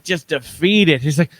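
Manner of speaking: ordinary talk, nothing marked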